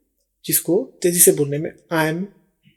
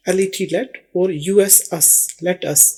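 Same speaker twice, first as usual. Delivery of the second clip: normal talking voice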